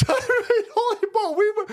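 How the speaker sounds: high laughing disbelief. Real, not acted. Streamer laughing at a news clip. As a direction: laugh it out in shock.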